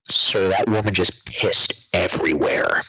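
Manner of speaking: ordinary talk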